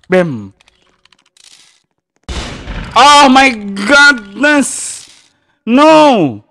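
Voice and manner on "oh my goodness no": high, slow, loud wail; dramatic dismay. Real, not acted. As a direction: drag out the horror. (kaget dramatis)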